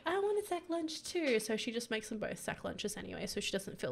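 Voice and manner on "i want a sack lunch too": high-pitched imitation of a toddler; little kid pleading. Player voicing toddler Josie wanting a lunch, high childlike plea. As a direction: pipe up like a child wanting one too. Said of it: "maybe Josie's like".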